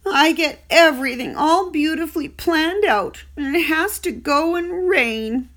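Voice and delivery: a small pig sulking that his plans are spoiled; disappointed young pig. Acted. Wilbur voiced in story.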